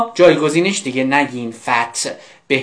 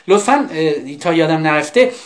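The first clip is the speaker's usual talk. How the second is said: ordinary talk, nothing marked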